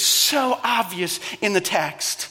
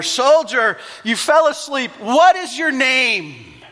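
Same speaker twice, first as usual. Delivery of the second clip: loud, higher demanding shout; 5 semitones higher, 6 dB louder, slower than usual. A narrator's reading. Alexander shouts at the sleeping guard, loud and commanding. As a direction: bark the question at the soldier. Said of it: "shouted all the more".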